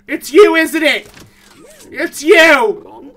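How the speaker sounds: alarmed and loud, startled by the monster; frightened. Real, not acted. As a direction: call out in fear.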